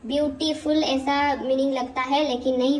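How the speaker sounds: normal talking voice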